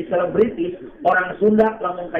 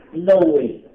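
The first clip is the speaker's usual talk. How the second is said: English phrase. Acted. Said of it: "itu bahasa Inggris".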